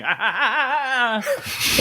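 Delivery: light villain cackle; quick mock evil laugh; evil laugh imitation. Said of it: "such a good laugh to end the act break".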